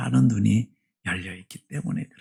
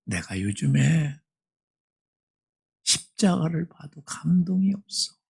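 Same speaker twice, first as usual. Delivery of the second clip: grieving confession; 2 semitones higher, 3 dB quieter than usual. Retold or imitated. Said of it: "이렇게 대답하더라".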